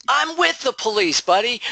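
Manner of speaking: high, cocky retort with a sneer; smug comeback. Retold or imitated. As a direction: toss it back like you hold the trump card. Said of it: "And you're like".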